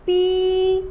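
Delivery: पी with a loud held ee; long stressed ee. Shown as a demonstration. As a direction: stretch the ee in पी. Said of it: "लंबा sound लेते हुए". (पी को लंबा और जोर से)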